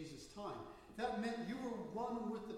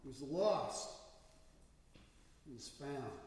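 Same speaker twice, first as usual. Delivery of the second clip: slow, solemn reading of the verse; scripture reading; slower than usual. Acted. Pastor reads the gospel to his congregation, solemn. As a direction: read the holy word with weight.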